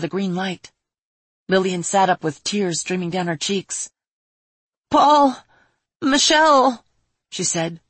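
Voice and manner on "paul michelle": high anguished cry of the names; loud, breaking voice calling out; voice cracking. Acted. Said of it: "as her voice cracked".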